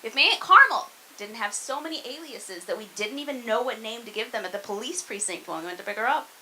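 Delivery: ordinary talk, nothing marked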